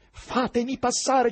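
acuto, forte e lento; ordine agitato. A narrator's reading. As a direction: pretendi il passaggio scandendo. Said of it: "disse Ivan agli infermieri". (perentorio, voce alta)

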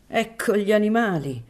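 high, indignant outcry, restrained volume; crying out. A narrator's reading. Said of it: "gridò Santa Fusca".

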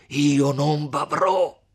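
distraught, furious threat; frenzied shout. A narrator's reading. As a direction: shout wildly in jealous rage. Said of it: "gridò Renzo con un viso più che mai stravolto".

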